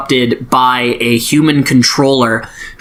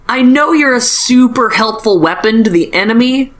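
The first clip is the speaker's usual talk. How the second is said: mock earnest alien; 9 semitones higher than usual. Axe voiced in retelling.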